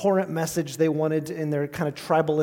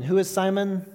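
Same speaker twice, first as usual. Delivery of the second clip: ordinary talk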